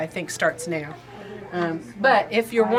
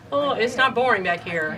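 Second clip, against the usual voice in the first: usual speaking voice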